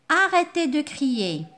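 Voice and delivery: sharp, loud scolding order; angry order. Shown as a demonstration. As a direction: tell the children off firmly. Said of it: "Un sentiment de colère. Je suis très en colère".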